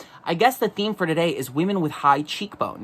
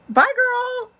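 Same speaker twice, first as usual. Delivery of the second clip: much higher, sing-song dismissive goodbye; sassy bye. Pretend. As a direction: flick her off sweetly. Mock dismissive farewell.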